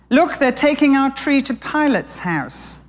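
put-on a child sparrow calling out about the tree; young sparrow exclaiming. A narrator's reading. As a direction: point it out like an excited small bird. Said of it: "claimed Harrow".

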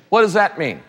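ordinary delivery

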